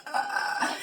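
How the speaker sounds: imitated choking gasp for air; breathless gasp. Showing how she couldn't breathe, mock breathless gasp. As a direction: croak like a fish out of water. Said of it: "I was like this".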